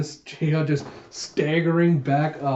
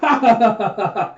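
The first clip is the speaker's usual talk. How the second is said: loud, higher acted ha-ha burst; mock laughter; 8 semitones higher, 7 dB louder than usual. Jayha losing it over Huck's poster, mock laughter.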